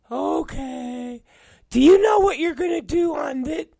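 high mocking falsetto; Will. Coworker quizzing his boss, high mocking falsetto. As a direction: pester in a squeaky, eager mimic voice. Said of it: "and he's like".

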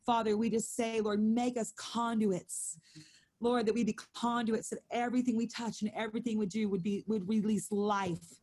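intercessory prayer to the Lord; earnest prayer. Acted. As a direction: pray it out over the room. Leads the prayer.

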